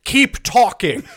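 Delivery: loud, high-pitched mock command; urgent urging. Hunters urging the chanting medium on, urgent. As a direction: shout it through amusement.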